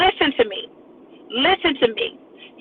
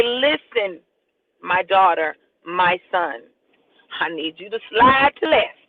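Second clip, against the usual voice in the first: tender voice of God; 6 semitones lower, slower than usual. Acted. Voicing God, low and soft.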